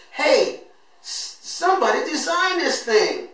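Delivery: high, loud excited cry out in class; excited outburst. Retold or imitated. Student calling out in class about a creature, excited loud outburst. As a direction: blurt it out, thrilled by the discovery.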